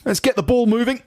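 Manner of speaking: high-pitched earnest blunderer; office chap's voice. Retold or imitated. Office chap mangles a saying, high put-on voice. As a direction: proclaim it high, as the muddled colleague.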